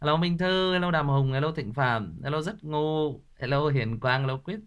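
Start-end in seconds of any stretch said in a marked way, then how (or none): none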